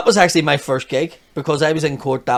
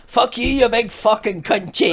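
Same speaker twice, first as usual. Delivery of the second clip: put-on Scottish accent; 7 semitones higher than usual. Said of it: "Anything in the Scottish accent is like".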